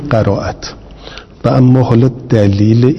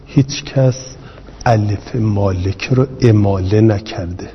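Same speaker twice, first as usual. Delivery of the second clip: ordinary delivery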